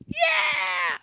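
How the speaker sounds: high, loud mock squeal; cheering sister. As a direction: cheer like a thrilled kid.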